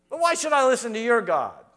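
scornful Pharaoh. Retold or imitated. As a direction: brush off their God with disdain. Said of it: "the Pharaoh was perfectly happy".

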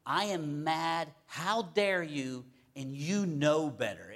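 slow, loud, emphatic indignation; indignant. Retold or imitated. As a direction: act out righteous outrage, word by word. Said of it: "Indignant means".

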